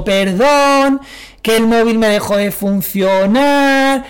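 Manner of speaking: high, slow, put-on sorry voice; mock apologetic excuse. To the friend she stood up, high mock apology. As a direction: fake a sugary apology.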